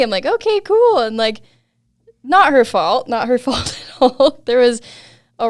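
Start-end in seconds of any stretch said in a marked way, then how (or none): 0.3-1.0 high, louder acted call back; retold agreement, pitched way up; agreeable reply. Rider accepts friend's word from above, agreeable acted reply.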